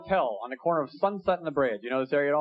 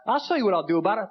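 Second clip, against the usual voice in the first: mock tough threat; 4 semitones higher, 5 dB louder, faster than usual. Retold fight, threatening line.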